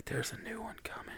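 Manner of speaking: fast excited whisper. Real, not acted. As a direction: whisper it like a secret. Teasing whisper about new game.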